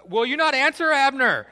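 high, ringing taunt hollered far off; full-voiced mocking call-out; trash-talk shout. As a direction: shout a challenge across a valley. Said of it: "David loves to talk trash".

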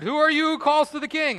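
high, loud indignant challenge; full-voiced demand shouted across a distance; shouted reply. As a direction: holler back at an unseen caller. Abner shouts back across the distance.

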